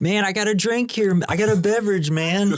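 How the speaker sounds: high-pitched mock whine of complaint; hammed-up high protest; protesting Dude. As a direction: imitate a slacker objecting as he's manhandled.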